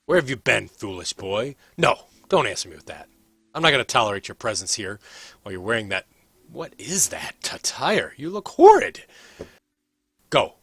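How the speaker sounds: loud, haughty reprimand; stern scolding mother. Acted. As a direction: order the foolish son away, disgusted. Stern mother scolds her returning son.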